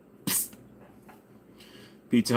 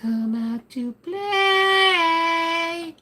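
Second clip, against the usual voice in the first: much higher, very slow sing-song taunt; Warriors taunt; much slower than usual. Retold or imitated. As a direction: drag it out like the movie's creepy call. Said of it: "the movie The Warriors".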